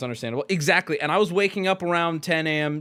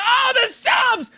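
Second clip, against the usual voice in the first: excited shout; 6 dB louder, slower than usual. Real, not acted. Reacts to incoming subs.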